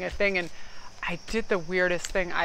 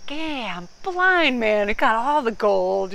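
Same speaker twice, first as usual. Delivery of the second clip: mock blinged-out brag; 4 semitones higher, 5 dB louder, slower than usual. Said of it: "You're like".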